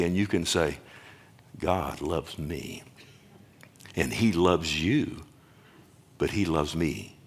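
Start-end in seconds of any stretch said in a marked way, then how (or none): 1.6-7.2 much softer and lower, slow; quiet affirmation. Shown as a demonstration. Teaching the congregation what to say, softly and slowly. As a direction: say it softly to yourself like a promise. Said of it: "I can say".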